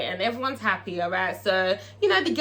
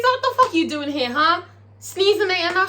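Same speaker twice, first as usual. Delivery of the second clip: raised, acted scolding; mock indignation; 7 semitones higher, 3 dB louder than usual. Uncle Clifford confronts Toy showing up, mock indignant. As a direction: challenge the intruder, higher and punchy.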